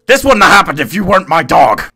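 high, loud frustrated scolding; exasperated yell. Real, not acted. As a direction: scold the dog at full volume. Scolding the dog.

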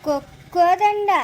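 slow, loud, halting stutter; laboured stammer. Acted. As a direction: stick on the first syllable, then force it out. Young man stammers out his name.

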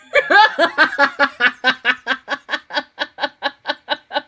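long, high, loud burst of laughter. Real, not acted. Viewer reacting to the show, long loud laughing fit. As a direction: laugh hard in a long run of quick pulses.

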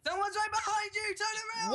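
high-pitched, urgent call; urgent shouted warning. Real, not acted. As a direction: shout to warn a teammate. Warning teammate of enemy behind.

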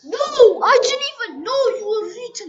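high, loud, fast protest; frantic protest. Real, not acted. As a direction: blurt it out frantically. Frustrated protest.

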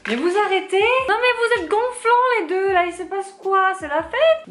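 real exasperated scolding. Real, not acted. Yelling at the two noisy pets interrupting the haul video, exasperated. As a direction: tell them off loudly, fed up.

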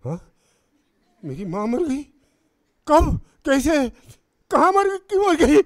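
loud, high, escalating panicked questions; acted shock, firing frantic questions upward; shocked disbelief. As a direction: cry out in horrified disbelief. Acting shocked reaction to mother's death.

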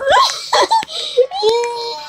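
high shrieking laugh. Real, not acted. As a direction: let out a giddy shriek of laughter.

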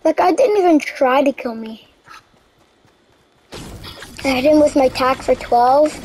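ordinary talk

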